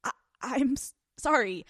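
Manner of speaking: stammered, reluctant apology; uncomfortable apology. Shown as a demonstration. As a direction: get stuck on the words, hating to apologize. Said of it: "the shrewdness in my voice".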